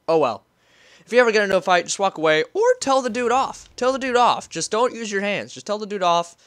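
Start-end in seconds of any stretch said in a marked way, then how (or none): 1.0-6.4 raised, insistent lecturing; preachy big-brother advice; emphatic advice. Real, not acted. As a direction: hammer the advice home, higher and louder.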